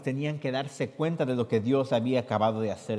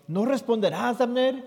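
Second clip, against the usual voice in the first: high-pitched call across the hill; lectura: llamando a gritos desde lejos; 11 semitones higher, 5 dB louder than usual. Acted. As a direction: call out to him from far off. David calls across to Abner.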